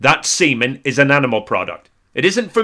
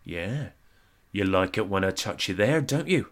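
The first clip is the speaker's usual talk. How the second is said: seductive murmur; 4 semitones lower, 8 dB quieter than usual. Pretend. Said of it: "And she'd say".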